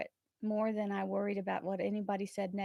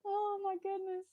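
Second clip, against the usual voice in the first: much higher, laughing through emotion; teary laugh; 12 semitones higher than usual. Real, not acted. She thanks a viewer, laughing through real tears. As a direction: laugh it off while choking up.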